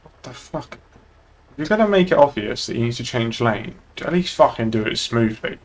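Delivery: ordinary talk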